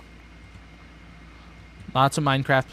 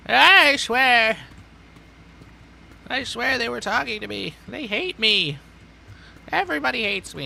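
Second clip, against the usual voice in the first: put-on much higher, loud paranoid old man; high cracking senile ranting; old man wail; 11 semitones higher, 7 dB louder than usual. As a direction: whine it like a doddering grandpa. Playing paranoid old grandpa.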